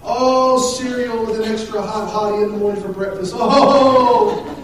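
hammed-up lovey-dovey swooning; laying it on super thick. Shown as a demonstration. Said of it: "You gotta lay it on super thick".

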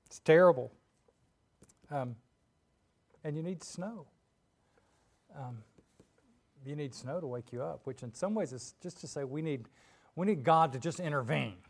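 ordinary talk

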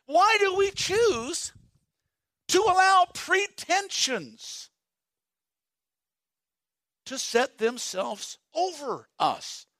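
slow, high pleading question; preaching. Acted. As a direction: stretch it out, pleading with the congregation.

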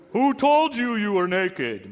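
slow booming God voice. Shown as a demonstration. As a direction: boom it out like an angry father. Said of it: "used the booming voice".

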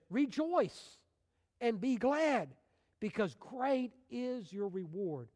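ringing call to rejoice, high and loud; exultant proclamation. Retold or imitated. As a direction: lift the pitch and stretch each key word.

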